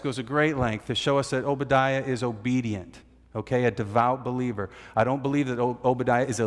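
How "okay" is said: normal talking voice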